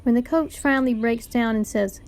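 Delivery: ordinary delivery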